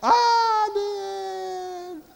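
high, loud, drawn-out wail; long falling yell. Acted. Preacher mimicking a fall before the splat, long high yell. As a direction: hold one high cry as it slowly sinks.

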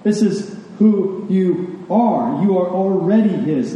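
slow build, stretching "are"; emphatic, drawn-out "are" and "already"; stress on ARE and ALREADY. Real, not acted. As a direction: linger on "are", then lean hard on "already". Driving home present identity.

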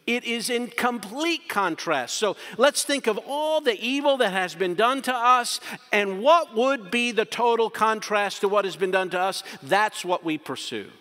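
preacher at full force; forceful preaching. Acted. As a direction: drive the contrast home from the pulpit.

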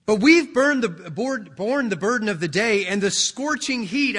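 loud, high indignant protest; aggrieved workers' complaint. Acted. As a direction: push the grievance at the master. Workers repeat their grievance.

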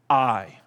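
a lone "I." set apart and leaned on; single out the word "I" with weight; stress on I. Shown as a demonstration. Preacher isolating the first word of the verse, stressed. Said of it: "these big five words".

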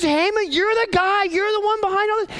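high, pointed accusation; accusing queen. Acted. Esther unmasks Haman before the king, high accusing voice.

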